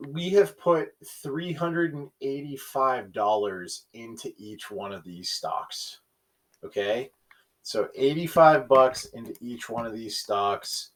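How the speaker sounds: normal talking voice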